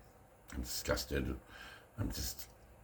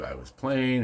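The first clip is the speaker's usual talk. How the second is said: high squeal; 10 semitones higher, 4 dB louder, slower than usual. Acted. Repeats line in high squeal.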